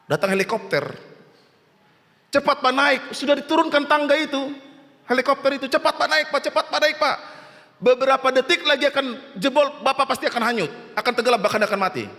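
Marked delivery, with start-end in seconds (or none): 2.3-12.1 high, pressing rescuer's call; urgent warning. Acted. Helicopter rescuer warns man to climb, high urgent calling. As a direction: act frantic urging, rushing the final warning.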